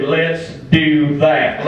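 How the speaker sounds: slow, stretched Southern drawl; drawn-out catchphrase. Acted. Rusty tells how he agreed to comedy, drawn-out drawl. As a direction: draw each word out proudly.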